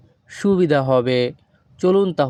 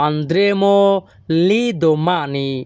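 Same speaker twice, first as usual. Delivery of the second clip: very slow, careful Italian sentence; slow Italian model; much slower than usual. Shown as a demonstration. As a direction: spell it out slowly for learners. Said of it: "এই বাক্যের Italian ভাষা হবে".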